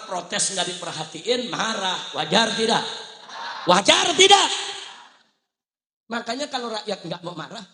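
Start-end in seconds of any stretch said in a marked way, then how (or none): 3.7-4.5 high, loud repeated challenge; real preacher's shout; rallying shout. Real, not acted. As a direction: belt the question to the crowd. Repeats louder to rouse crowd.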